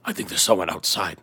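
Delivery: low hushed worried voice; hushed whisper. Pretend. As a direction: warn someone is outside quietly. Acted Bilbo paranoid scene.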